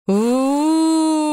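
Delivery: hohes, lautes Geisterheulen; gespenstisches Uh. Acted. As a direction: ein Gespenst heult schrill auf. Geräusch aus dem verlassenen Haus. (Gespenst im Haus heult noch einmal, hoch und laut)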